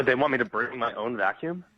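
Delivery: normal talking voice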